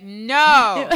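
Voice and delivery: loud, drawn-out mock refusal; emphatic refusal. Joking about her own offspring, loudly emphatic. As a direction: belt out a comic no.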